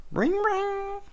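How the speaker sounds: high held ring ring; phone ring imitation. Shown as a demonstration. High phone ring imitated for the pets. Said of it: "Or brum?".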